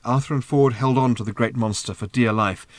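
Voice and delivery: ordinary delivery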